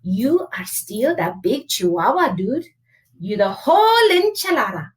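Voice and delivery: hammed-up Spanish accent; playful mock-Spanish accent, swooping up at the end. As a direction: put on your best storybook Spanish accent. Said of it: "using his very best Spanish accent".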